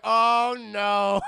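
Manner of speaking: loud, high Oh no; real shout of dismay. Real, not acted. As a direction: cry out in comic horror. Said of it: "And I'm like".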